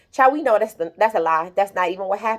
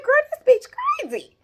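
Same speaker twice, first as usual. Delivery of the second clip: incredulous. Retold or imitated. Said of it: "I'm like".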